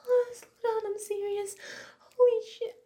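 high, loud cries of pleasure; loud moaning cries. Acted. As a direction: let it burst out in loud, high moans. Intense sex scene, crying out.

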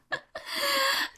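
squeaky high laugh breaking the act; a real giggle cracking the menace; high burst of giggling. Real, not acted. Breaks into laughter mid-threat.

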